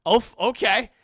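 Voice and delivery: loud laughing hype; hyped laughing. Retold or imitated. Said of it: "I'd be like".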